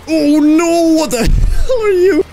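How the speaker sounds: loud, high cry of alarm with a laugh; startled scream. Real, not acted. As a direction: yelp in shock at a sudden creature. Startled by a snake enemy.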